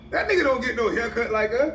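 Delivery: high, sneering gossip about the haircut; mocking high voice.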